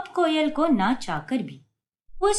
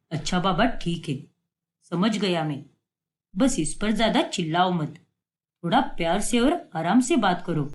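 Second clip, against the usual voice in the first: a hint of a calm father, lower voice; easygoing dad smoothing things over; lightly voiced father; 7 semitones lower than usual. Father soothing his wife who scolds their daughter, lower and calm. Father gives in, asks gentleness.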